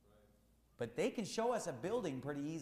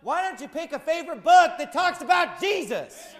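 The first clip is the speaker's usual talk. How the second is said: loud rebuke; 13 semitones higher, 12 dB louder than usual. Real, not acted. Rebuking the building pastor.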